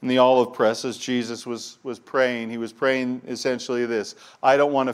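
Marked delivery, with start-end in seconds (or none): none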